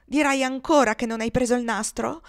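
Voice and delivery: stern scolding question; indignant challenge. Acted. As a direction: challenge the liar triumphantly and sternly. Mistress confronts her with proof.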